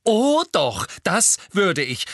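mean every word of it; grim. A narrator's reading. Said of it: "sagte Hermine grimmig".

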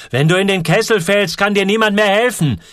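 high, loud, urgent; urgent warning. A narrator's reading. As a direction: drive the danger home. Man warns boy of fire. (dringende, laute Warnung)

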